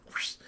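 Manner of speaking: whooshing noise; imitated whoosh. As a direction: mimic something whooshing past. Imitates a tossed thing flying.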